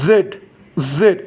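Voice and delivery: জেড with a buzzing z, "zed"; buzzed Z. Shown as a demonstration. Teacher modelling Z for Bengali learners, buzzed. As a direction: buzz the start of জেড. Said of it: "exactly উচ্চারণটা এরকম".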